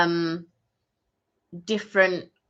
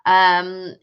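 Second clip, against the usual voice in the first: ordinary talk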